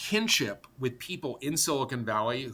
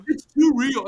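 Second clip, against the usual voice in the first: mocking whine; 10 semitones higher, 10 dB louder, slower than usual.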